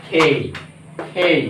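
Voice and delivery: খেই with a puff after the k; correct letter K. Shown as a demonstration. Teacher reviewing the different letters, aspirated খেই. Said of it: "খেয়াল করতে হবে একটু আলাদা".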